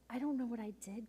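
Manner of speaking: imitating a hurt boy in a soft whisper; tearful whisper. Youth leader voicing her crying student to the group, whispered. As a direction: breathe the words, lost and hurt.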